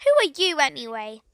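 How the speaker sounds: plain reading voice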